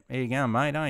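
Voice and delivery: really Aussie accent. Shown as a demonstration. Streamer showing viewers how Aussie it gets, broad Aussie accent. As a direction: lay the Aussie on thick. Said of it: "you can get really Aussie like that".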